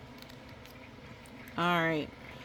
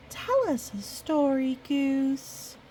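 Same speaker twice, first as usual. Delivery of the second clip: put-on high pleading kid voice, higher; begging friends; 8 semitones higher, slower than usual. Friends beg Goose for a story, pleading high. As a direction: whine it like eager little friends. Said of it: "His friends would always beg him".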